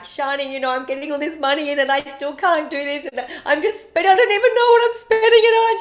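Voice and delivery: blurting it out while crying on the phone; distraught pleading. Retold or imitated. Crying while driving, pleading with friend Shani on speakerphone, high frantic rush. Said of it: "Bawling my eyes out".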